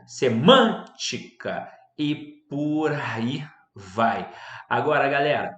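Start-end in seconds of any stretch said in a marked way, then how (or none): 0.1-1.7 semântica slowed, MÂN punched out; stress on antepenultimate syllable. Shown as a demonstration. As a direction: stretch semântica and hit MÂN hard. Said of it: "sílaba tônica na antepenúltima sílaba". (semântica silabado, com força no MÂN)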